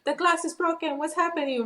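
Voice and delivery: high, panicked teen voice imitating fright; freaked-out son. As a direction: retell the son's alarm, voice jumping up high. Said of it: "he freaked out".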